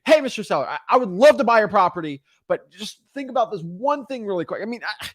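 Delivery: high, exaggerated salesman enthusiasm; hammed-up, excitable buyer; matched high energy. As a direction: pitch the seller with over-the-top energy. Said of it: "you gotta match energy".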